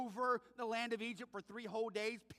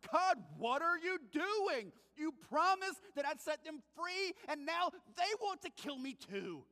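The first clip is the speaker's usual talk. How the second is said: a frustrated Moses demanding answers from God; doubting Moses; 8 semitones higher, 5 dB louder than usual. Retold or imitated. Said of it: "even Moses starts to doubt".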